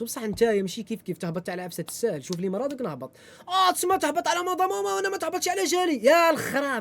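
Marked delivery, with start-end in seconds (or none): 3.5-6.8 high put-on voice mimicking his friend; mocking the friend. Mimicking his friend's argument about moving, sarcastic high voice.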